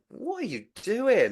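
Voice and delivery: baffled at the dog missing weaves; confused. Retold or imitated. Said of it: "I was just so confused".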